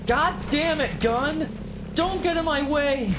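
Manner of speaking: high angry yelling; frustrated yell. Real, not acted. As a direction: snap at the game. Yelling at game in combat.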